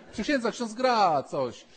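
put-on high, eager kid begging the priest; pleading child. Children nag the young cleric to play, high and pleading. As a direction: beg like an impatient child.

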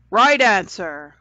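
loud, slow, ringing declaration; bell-like voice. A narrator's reading. Unseen voice rules on Jared's answer, ringing out bell-like. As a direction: ring it out like a bell. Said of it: "a bell-like voice declared".

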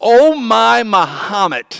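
lightly put-on exclaiming voice; mock exclamation. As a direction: land the joke with a slow mock gasp. Friend's joke punchline.

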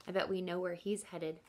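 usual speaking voice

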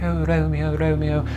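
overdone modern stage actor swooning; mock stage Shakespeare. Mocking how modern Shakespeare is performed, hammed-up and high. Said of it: "modern Shakespeare that they do now".

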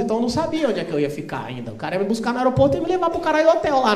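ordinary talk

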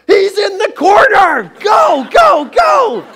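high, loud shout across the store; yelling. Retold or imitated. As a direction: yell to the whole store. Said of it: "I just yell to the whole department store".

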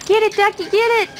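high, eager egging on; excited urging. Real, not acted. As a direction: egg the dog on, excited. Urging dog to play.

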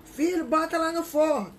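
loud call across the house; calling out to son. Shown as a demonstration. Mother calling her son from the kitchen, loud shout. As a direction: shout it to the kid in the other room. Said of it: "Pro filho a gente grita assim".

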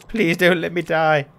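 high, loud plea; desperate begging. Real, not acted. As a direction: beg for your life in a panic. Begging as time runs out.

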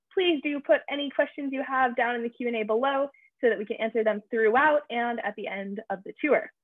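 ordinary talk

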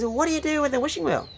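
very high mock wife voice; put-on falsetto-like feminine asking; wife's voice. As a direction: squeak out a curious question as the wife. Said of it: "She goes".

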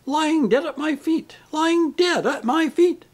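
very high, melodramatic lament; hammed-up, high-pitched repetition; repeating tragedian. A narrator's reading. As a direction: chant it in escalating stage anguish. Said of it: "go on repeating the phrase".

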